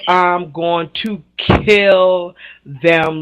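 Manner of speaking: drawn-out deadpan threat; mock dread at her friends; very slow. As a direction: stretch every word out slowly. Inner reaction to date.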